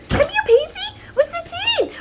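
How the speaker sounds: very high cooing pet voice. Real, not acted. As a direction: call the puppy in a squeaky coo. Calling the dog over.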